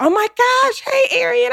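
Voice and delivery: high-pitched gushing fan hello; excited greeting. Retold or imitated. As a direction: squeal a retold red-carpet greeting. Retold greeting by red carpet.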